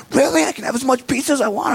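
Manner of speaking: put-on very high, breathless child's disbelief; a six-year-old barely containing himself; excited kid. Kid amazed at unlimited pizza.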